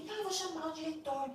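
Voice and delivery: high-pitched imitation of a stressed, combative man; retold angry demand. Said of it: "ele era muito estressado, bravo, briguento".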